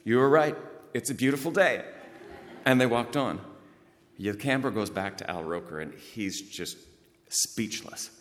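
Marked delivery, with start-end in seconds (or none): 0.0-1.8 high and loud, beaming; cheerful proclamation. Retold or imitated. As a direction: call it out warmly to the weatherman. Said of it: "he looked at Al Roker and he went".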